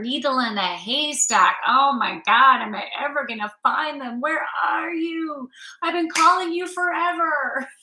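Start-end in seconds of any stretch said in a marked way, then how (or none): 1.7-7.7 high, loud desperate calling out; desperate crying out. Acted. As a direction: wail like a lonely searcher. Acts out a desperate seeker.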